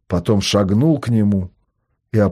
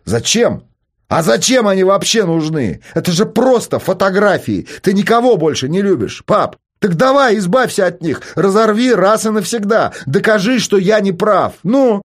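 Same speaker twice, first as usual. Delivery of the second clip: higher, louder provoking yells; goading shouts; 11 semitones higher, 3 dB louder than usual. A narrator's reading. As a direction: dare the father to prove you wrong. Son shouts, goading grieving father. (вызывающий крик)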